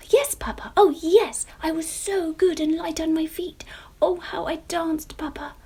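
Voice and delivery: high, loud eager pleading; eager child. A narrator's reading. Jemima answering papa, high and eager. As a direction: beam it up to papa.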